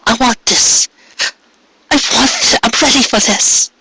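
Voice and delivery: high, pleading female voice; Cupcake's high voice, pleading for a foal; pleading mare. A narrator's reading. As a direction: beg in a high mare's voice. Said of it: "she repeated".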